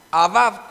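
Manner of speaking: "abad" said loud, stressed on the second a; stress on the final A. Shown as a demonstration. Preacher teaching congregation a Hebrew word, "abad" stressed on final A. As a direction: punch the last A of "abad". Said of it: "con acento en la A".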